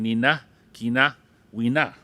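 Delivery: nina, kina, wina with clear n; Swampy Cree pronunciation drill; Cree word series. Shown as a demonstration. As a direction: drill the n forms for the class.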